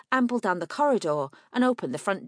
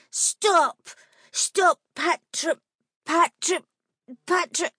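raised, stuck on the word; upset stammering shout; 9 semitones higher, 5 dB louder, much slower than usual. A narrator's reading. Flustered girl shouts at the treasure hunter, stammering. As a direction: blurt it out, tripping over the word.

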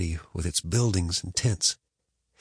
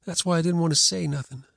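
put-on higher, sulky boy; boy's voice; 8 semitones higher than usual. A narrator's reading. Boy sulking.

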